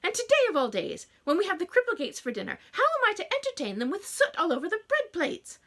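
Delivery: loud, high wailing tirade; fussy mother scolding her daughter; melodramatic mother. A narrator's reading. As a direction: rant like it's a catastrophe.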